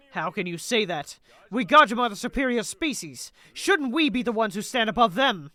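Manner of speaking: mildly heated debate voice; lightly voiced, emphatic game figures; indignant zealot. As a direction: argue the point with theatrical insistence.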